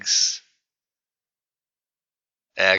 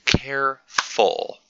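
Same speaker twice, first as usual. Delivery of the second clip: "Careful" in two separate beats; split into syllables; 5 semitones higher, slower than usual. Shown as a demonstration. Teacher counting syllables for students, "Careful" split in two. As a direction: break "Careful" apart as care, ful. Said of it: "two syllables".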